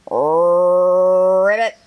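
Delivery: low drawn-out froggy ribbit; frog croak. Acted.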